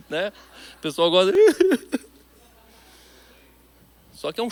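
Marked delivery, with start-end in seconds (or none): 1.4-2.0 short high laugh, real; burst of laughter. Real, not acted. Pastor laughing at himself crying at the movies, a high laugh. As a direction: laugh out at yourself.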